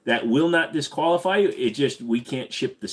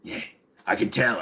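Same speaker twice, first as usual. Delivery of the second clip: normal talking voice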